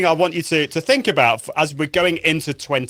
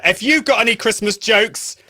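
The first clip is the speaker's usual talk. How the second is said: ordinary talk, nothing marked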